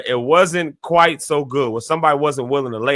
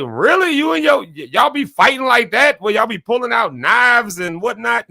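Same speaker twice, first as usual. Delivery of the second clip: disbelieving; 10 semitones higher than usual. Retold or imitated. Friends question the couple's fighting.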